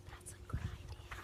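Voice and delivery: soft whispered approval; hushed whispered aside. Real, not acted. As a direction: whisper it gently. Whispered aside while reading.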